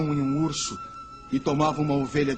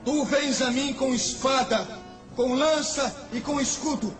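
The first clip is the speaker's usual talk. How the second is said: fervent proclamation; 9 semitones higher than usual. Acted. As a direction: proclaim it with holy fire. Davi proclama diante do gigante. (proclamação fervorosa e exaltada)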